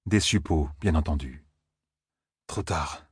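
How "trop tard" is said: low, soft murmur. A narrator's reading. Hero seeing the monsters arrive, murmured. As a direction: murmur it under your breath, dropping the pitch. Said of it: "murmura Rand".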